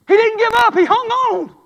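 repeated line rung out to the church; preached shout. Shown as a demonstration. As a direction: hammer it home with a shout. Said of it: "repeated louder".

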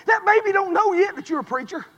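ordinary talk

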